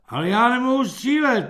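cross-eyed recruit claims exemption, triumphant. Acted. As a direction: play your trump card. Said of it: "triumfuje šilhavec".

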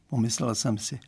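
plain reading voice

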